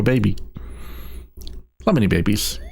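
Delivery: usual speaking voice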